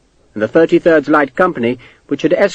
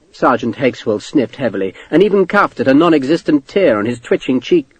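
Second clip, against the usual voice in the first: usual speaking voice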